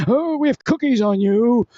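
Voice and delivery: high, loud, drawn-out mock voice; hammed-up slow, high jeering; mocking taunt. Mocking voices between bursts of laughter.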